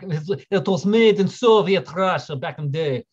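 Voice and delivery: slow, hammed-up Russian accent; shoddy Russian accent. Streamer describing where the film was made, in a shoddy Russian accent. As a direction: put on a bad, drawn-out Russian accent. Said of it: "That was a really shitty Russian accent".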